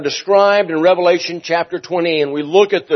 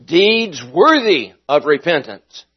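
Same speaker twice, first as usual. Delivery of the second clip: ordinary delivery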